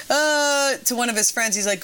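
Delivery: usual speaking voice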